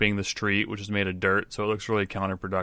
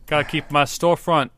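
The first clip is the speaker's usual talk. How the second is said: street sweeper; 7 semitones higher, 5 dB louder than usual. Acted. Sweeper defends his sweeping.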